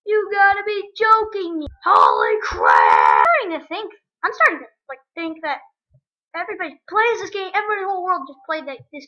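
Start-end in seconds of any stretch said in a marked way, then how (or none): 0.0-3.3 loud, drawn-out exasperated yells; outraged shouting. Real, not acted. Gamer who keeps losing at a level, outraged shouting. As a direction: rage at the game in bursts. Loses again in game.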